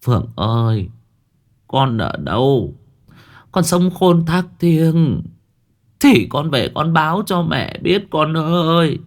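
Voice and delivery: slow, high, tearful calling to a lost son; mother choking up, begging her dead son to answer; slow, rising wail of an old mother; whimpering plea. Acted. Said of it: "Bà cụ đến đây thì mếu máo".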